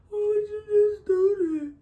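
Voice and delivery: high, loud, stunned "why" after the slap; hurt girlfriend. Pretend. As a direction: cry it out like she just got hit. Acted victim after being hit.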